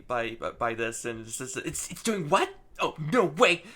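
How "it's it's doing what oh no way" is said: flipped out. Retold or imitated. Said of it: "Surge is really flipped".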